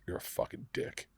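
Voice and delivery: hissed under the breath; whispered insult. Retold or imitated. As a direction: whisper it through your teeth. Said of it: "he goes".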